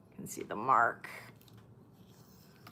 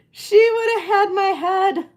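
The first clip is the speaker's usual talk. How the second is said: laughing; 4 semitones higher, 3 dB louder than usual. Real, not acted. Laughing relief after mistake.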